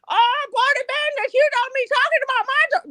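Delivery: indignant mother. Acted. Mother telling off Booty Bandit for talking about her daughter, indignant. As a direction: wail the warning way up high, stretching the opening.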